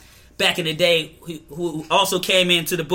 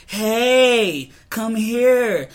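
siren voice; 5 semitones higher, 3 dB louder, slower than usual. Retold or imitated.

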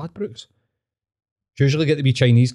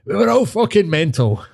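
old street character impression; 7 semitones higher than usual. Couple's running joke about an old man, high mimicry. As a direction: parrot the old guy in a high voice. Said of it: "me and my missus just randomly just go".

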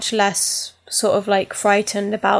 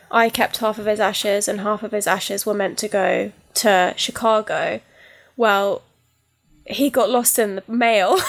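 ordinary talk

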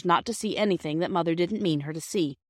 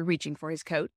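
usual speaking voice

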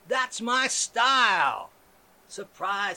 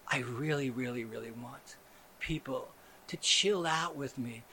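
much lower and softer; mock serene plea; hushed mellow; 10 semitones lower, 9 dB quieter than usual. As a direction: breathe it gently. Very soft pleading calm.

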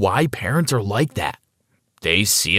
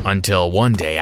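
plain reading voice

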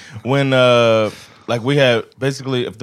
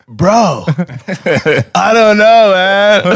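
laughing, higher and louder; imitated flustered dude through laughter; 6 semitones higher, 6 dB louder, much slower than usual. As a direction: lose it while answering. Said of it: "dude's like".

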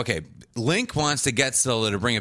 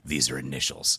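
low, deadpan detective line; mock Michael deduction; 10 semitones lower, 3 dB quieter than usual. Friend recapping the episode, lowering voice for Michael's deduction. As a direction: drop low and state it gravely. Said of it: "And he's like".

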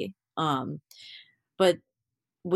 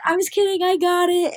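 excited reveal; 14 semitones higher, 8 dB louder than usual. Retold or imitated. Revealing the prank to parents.

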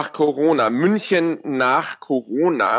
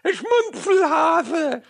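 put-on slow, high lisping kid voice; lisping child; 12 semitones higher, much slower than usual. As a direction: lisp it like a small child in an ad. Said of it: "dann lispelt dieses Kind immer so".

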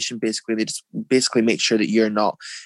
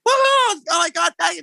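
high, loud hammed-up woohoo; mock excited scream; 4 dB louder than usual. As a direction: squeal it like a contestant who just won. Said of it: "I wasn't like that".

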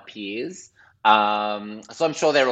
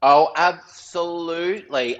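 a slow, high, louder oh, absolutely; drawn-out emphatic agreement; 6 semitones higher, 4 dB louder, slower than usual. Real, not acted. Agreeing with the host, slow and hammed-up. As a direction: stretch the oh out in grand agreement.

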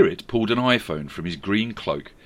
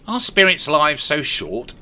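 slow, raised character voice; Scrooge voice; 7 semitones higher, 7 dB louder than usual. A narrator's reading. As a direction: ask it slowly, worried.

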